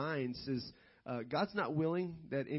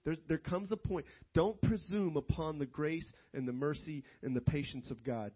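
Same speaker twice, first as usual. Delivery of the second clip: ordinary talk, nothing marked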